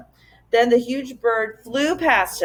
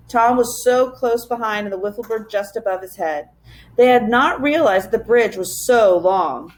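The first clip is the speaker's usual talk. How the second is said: usual speaking voice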